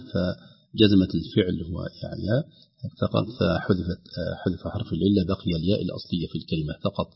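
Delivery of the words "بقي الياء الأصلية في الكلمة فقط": ordinary talk, nothing marked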